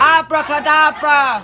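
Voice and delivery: magic spell. Pretend. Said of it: "wiggle their fingers, say abracadabra".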